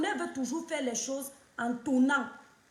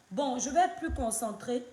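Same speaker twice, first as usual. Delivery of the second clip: ordinary delivery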